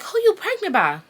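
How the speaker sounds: very high, insistent auntie voice; mock prying auntie. Auntie questioning her in the living room, high-pitched prying. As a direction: imitate an aunt pressing for the name. Auntie retold demanding the father's name.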